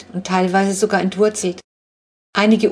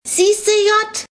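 high, loud gloating shout; shrill little-sister victory cry; triumphant yell; 4 dB louder than usual. A narrator's reading. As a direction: crow it like a kid who just won. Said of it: "brüllt Flo triumphierend".

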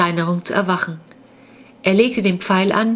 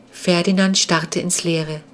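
ordinary delivery, nothing marked

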